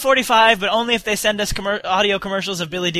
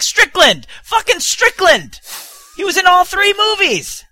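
higher, louder excited yelling; excited shout; 11 semitones higher, 4 dB louder, slower than usual. Retold or imitated. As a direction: blurt it out like a fan. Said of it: "And I was like".

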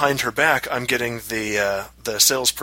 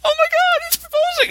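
gushing onlookers. Retold or imitated. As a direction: shriek it like excited diners. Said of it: "all the women around us are".

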